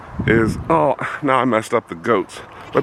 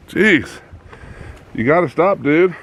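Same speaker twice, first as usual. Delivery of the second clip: scolding a pet; 6 semitones higher than usual. Real, not acted. Scolding the rubbing goat buck.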